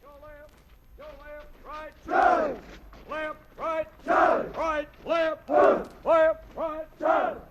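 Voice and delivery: shouted drill commands. Real, not acted. Drill commands while marching.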